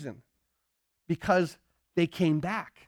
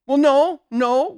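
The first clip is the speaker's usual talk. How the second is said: loud mock admission; 5 semitones higher, 8 dB louder, slower than usual. Counselee admitting.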